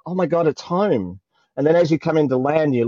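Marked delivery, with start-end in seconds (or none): 0.0-1.2 retold homecoming feeling. Traveller feeling she is home, retold excitement. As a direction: relive the thrill of getting home. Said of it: "that feeling of".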